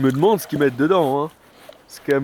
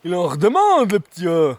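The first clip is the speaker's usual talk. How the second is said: mocking tease; 5 semitones higher, 6 dB louder than usual. Real, not acted. Mocking the friend's bravado.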